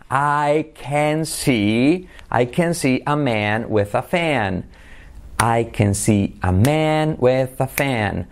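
very slow, each word stretched; slow practice model. Shown as a demonstration. Teacher modelling the practice sentence, slowly. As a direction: draw out "eh-a" in CAN, MAN, FAN. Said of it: "la frase que he preparado para la práctica".

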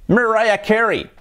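high, slow "Mariah Carey" as mer-RYE-a KE-ree; correct English pronunciation. Shown as a demonstration. Teacher repeating the correct name, slow English pronunciation. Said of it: "la pronunciación correcta sería".

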